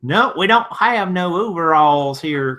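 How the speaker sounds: slow, lazy Southern drawl put on for a viewer; lightly hammed-up Southern accent. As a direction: play up a Southern accent for the chat. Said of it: "Was that a good enough Southern accent for you?".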